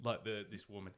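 ordinary talk, nothing marked

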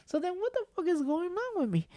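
confused about his own body; high, bewildered self-question; baffled. Retold or imitated. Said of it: "I was, like, saying".

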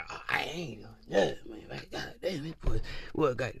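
soft, hushed phone murmur; mock playing it cool. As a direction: mumble it low as if hiding arousal. Imitating lady hiding arousal on phone.

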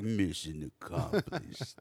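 imitating Rambo's movie line; gruff Rambo quote. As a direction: growl it like an action hero.

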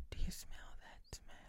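eerie hushed whisper. Acted. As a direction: breathe the line out, spooky. Spooky whispered joke.

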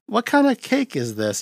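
a hint of a puzzled Igor; quoting Igor. Retelling movie dinner scene.

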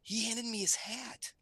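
high imitation of an amazed kid; lightly voiced child sharing big news; daughter telling dad. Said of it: "And she goes".

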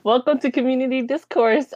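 podcast host intro. Acted. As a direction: open the show with flair.

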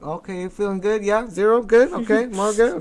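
loud, high, choppy mock patient talk; mock brusque interpreter. Hospital worker rushing a patient out, loud mock brusque voice. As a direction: hustle the patient out in loud, simplified phrases.

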